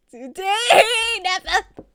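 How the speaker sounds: high, loud cackling through the words; can't stop laughing at a teasing friend; laughing hard. Real, not acted. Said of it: "Neffa's threatening me".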